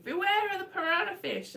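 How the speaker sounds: high, hammed-up warning announcement; ride voiceover. Teller mimics the jungle ride's warning, mock voiceover. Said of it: "like, a little voiceover saying".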